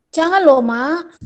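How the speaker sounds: put-on mother quoting her toddler's pushy stress, loud and slow; bossy emphasis. Mother imitating toddler telling her off, bossy stress. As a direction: press each word like a bossy toddler. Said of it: "menekannya seperti ini".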